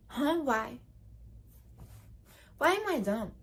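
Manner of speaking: soft, puzzled, playing dumb; feigned innocence. Shown as a demonstration. Showing how to answer a mean joke, playing dumb and puzzled. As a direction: ask it like you truly don't get it. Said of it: "Say like".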